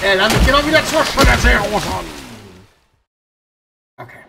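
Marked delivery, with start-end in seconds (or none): 0.0-1.9 louder, fed-up outcry; exasperated shout. Real, not acted. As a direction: yell it in frustration. Same fail again, exasperated.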